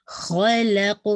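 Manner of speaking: خلق drawn out slowly with a heavy خ and a bounced ق; heavy khaa. Shown as a demonstration. Teacher modelling heavy خ and qalqala in خلق. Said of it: "خا heavy رہے گا".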